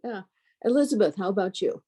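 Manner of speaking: ordinary delivery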